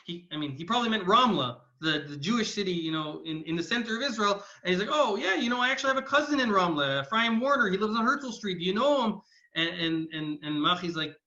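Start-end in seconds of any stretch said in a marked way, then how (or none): 4.9-9.2 higher, louder, eager chatter; eager small talk. Retold or imitated. Host eagerly claims a cousin in his guest's town, animated. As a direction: make an enthusiastic connection with the guest.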